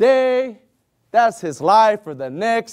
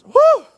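whistling whew; 7 dB louder than usual. Real, not acted. Exhale at scourgings.

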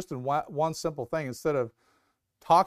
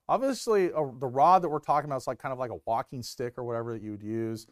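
normal talking voice

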